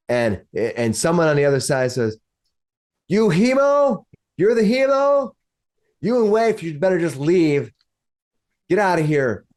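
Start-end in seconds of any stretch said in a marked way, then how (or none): 3.1-9.5 high-pitched call from behind a door; mock shout that drops in pitch as it goes; acted shouting. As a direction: challenge them with a raised voice, pitched high at first. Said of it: "hear him shouting".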